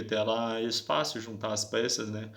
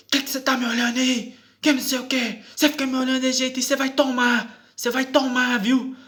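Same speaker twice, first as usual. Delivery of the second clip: high, loud mimicked policewoman threatening; thuggish threat, imitated; 12 semitones higher, 7 dB louder than usual. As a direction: threaten loudly in a mocking high voice. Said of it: "A linguagem, tipo assim, parecendo um bandido".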